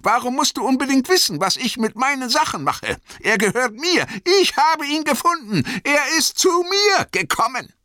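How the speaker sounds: high, loud, possessive outburst; Bilbo, zornig. A narrator's reading. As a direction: protest furiously in a high hobbit voice. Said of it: "ein zorniges Glitzern trat in seine Augen". (zornig, laut und hoch)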